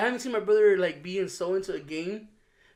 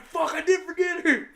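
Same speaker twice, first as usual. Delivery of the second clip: higher, louder hammed-up dad panic; mock panicked dad; 11 semitones higher, 6 dB louder than usual. Brother on phone realizing he forgot daughter, hammed-up panic. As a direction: overplay the panicking father. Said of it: "he's like".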